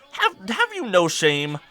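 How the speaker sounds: high, slow theatrical reproach; mock indignation. Scolding someone who begs for food, mock indignant.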